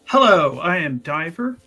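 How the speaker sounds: ordinary talk, nothing marked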